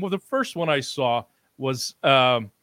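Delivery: ordinary delivery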